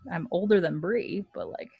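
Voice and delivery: usual speaking voice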